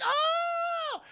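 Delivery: long, very high-pitched scream; hammed-up horror-film wail; mock scream. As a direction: shriek as if grabbed from behind. Victim grabbed, screams in retelling.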